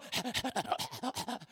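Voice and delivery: imitated strangled dog gasp; mock choking dog noise. Pastor imitates the dog choked by its chain, mock gasp. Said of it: "and then the dog go".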